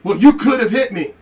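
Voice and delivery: mock whine of a guy crying victim. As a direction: sneer his complaint back at him. Mocking the guy's claim.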